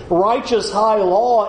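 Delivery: ordinary delivery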